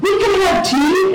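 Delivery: high, slow mock yell; imitated shouting. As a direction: call it across the wind, drawn out. Shouting to friend in the storm.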